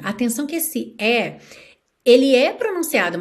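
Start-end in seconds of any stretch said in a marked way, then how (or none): none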